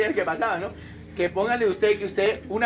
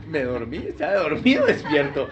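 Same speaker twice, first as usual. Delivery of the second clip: bewildered half-laugh; confused, laughing; 5 semitones lower than usual. Retold or imitated. Preacher mocking himself waking mid-prayer, laughing. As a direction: laugh at your own confusion. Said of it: "yo digo".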